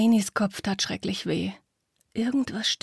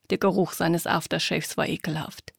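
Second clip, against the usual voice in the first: ordinary delivery, nothing marked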